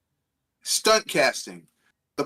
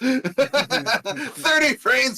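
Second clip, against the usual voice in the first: mock snob voice, much higher and slow; snooty PC gamer; 8 semitones higher, much slower than usual. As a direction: sneer down at console players. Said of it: "being like".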